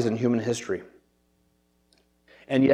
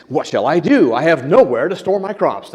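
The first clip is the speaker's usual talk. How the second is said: tongue-in-cheek dramatic; 3 semitones higher, 4 dB louder than usual. Acted. Preacher voicing Jesus telling the parable, mock-dramatic. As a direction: play it over-dramatic, swooping up and down. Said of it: "this is dramatic interpretation of Jesus".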